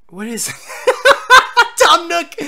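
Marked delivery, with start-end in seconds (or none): 0.5-1.7 loud, high-pitched cackling laugh; bursting laughter. Real, not acted. Bursts out at the Tom Nook shot. 1.8-2.3 high, loud laughing cry; delighted outburst. Real, not acted. Delighted at seeing Tom Nook.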